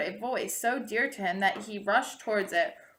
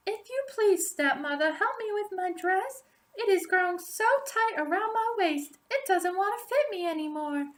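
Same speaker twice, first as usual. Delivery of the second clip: put-on much higher, sweet girlish voice; innocent Rapunzel voice; 10 semitones higher than usual. A narrator's reading. Rapunzel innocently asking her stepmother for help with her dress. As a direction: ask sweetly like a naive young girl. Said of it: "Rapunzel said".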